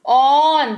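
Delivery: ऑन said with an open 'aw' vowel, like English 'on'; teacher demonstrating the open o; pronunciation demo. Shown as a demonstration. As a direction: model ऑन clearly with the open 'aw'. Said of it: "उच्चारण कैसे करते हैं ऑन का?".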